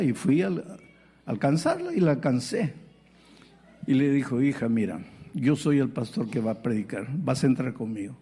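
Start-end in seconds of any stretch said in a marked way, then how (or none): 4.4-8.2 low, tender invitation; gentle coaxing. Retold or imitated. Pastor gently inviting the woman in, tender. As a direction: soothe her like a daughter.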